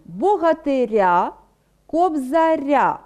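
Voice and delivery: Богатиря, кобзаря drawn out, stress on the final syllable; teacher showing the stress; stress on the ending. Shown as a demonstration. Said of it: "Наголос у нас стоїть на іншому складі".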